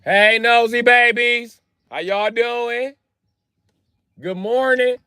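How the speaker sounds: high, slow cooing hello; sweet livestream greeting. Real, not acted. Greeting livestream viewers, high slow cooing. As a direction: coo hello to viewers slowly. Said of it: "I'm on live".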